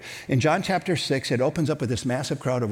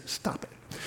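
whispered firm stop; quiet stop it; 13 dB quieter than usual. Retold or imitated. Jesus gently telling his followers to stop, whispered. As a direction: hush it out like a gentle rebuke. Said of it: "He just says".

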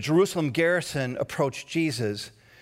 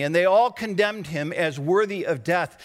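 ordinary talk, nothing marked

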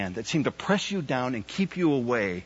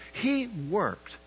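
ordinary talk, nothing marked

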